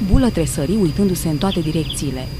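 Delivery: normal talking voice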